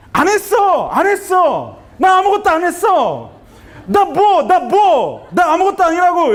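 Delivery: high-pitched, defensive outburst; acted flustered husband snapping back; angry denial. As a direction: protest your innocence in a high, indignant voice. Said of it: "이렇게 막 화를 냅니다".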